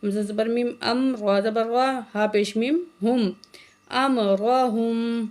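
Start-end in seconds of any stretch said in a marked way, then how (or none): 3.9-5.3 امرہم with a thick, heavy ر. Shown as a demonstration. Teacher shows the heavy ra to students, slow chant. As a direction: round out the ر in امرہم fully. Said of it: "را کو موٹا کر کے پڑھیں گے".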